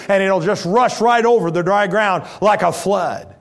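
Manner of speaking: loud, rising fire-and-brimstone build; preaching at full force. Acted. Preacher warning the church of God's wrath, full force. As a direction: thunder it at the congregation.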